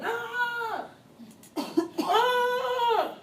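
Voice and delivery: high, loud mock yell of ah; imitated patient yelling. Nurse imitating the yelling syphilis patient, high loud mock yells. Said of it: "she yelling".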